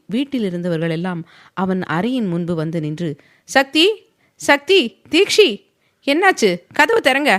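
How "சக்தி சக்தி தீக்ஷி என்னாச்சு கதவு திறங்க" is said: much higher, anxious call through a door; mother worried at the door; alarmed high pleading call; frightened calling. Acted. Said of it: "பயந்தபடி கதவை தட்டினார்".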